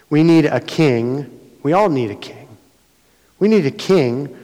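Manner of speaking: loud, insistent plea for a king; people demanding a king. Retold or imitated. Preacher voicing Israel demanding a king, loud. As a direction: call out for a king like the crowd.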